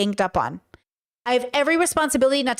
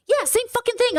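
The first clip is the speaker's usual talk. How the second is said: mock brother in a much higher, sneering voice; 10 semitones higher than usual. Retelling to viewers, mimicking her brother's high dismissive voice.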